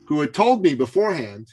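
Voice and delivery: ordinary delivery, nothing marked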